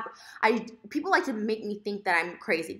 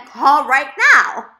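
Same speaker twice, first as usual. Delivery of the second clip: loud, slow, higher-pitched command; emphatic retold order; 6 semitones higher, 16 dB louder, much slower than usual. Quoting his order to phone the restaurant, loud and emphatic. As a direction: belt out each word slowly. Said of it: "because he said".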